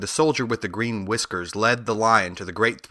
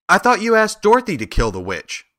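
higher, louder burst of surprise; surprised; 10 semitones higher, 4 dB louder than usual. A narrator's reading. Scarecrow questioning Oz about Dorothy's task, surprised. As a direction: blurt it out in surprise, pitched up. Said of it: "said the Scarecrow in surprise".

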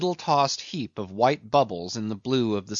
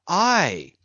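Starbuck cutting the carpenter short; 4 semitones higher than usual. A narrator's reading. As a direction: snap it out impatiently. Starbuck's curt answer.

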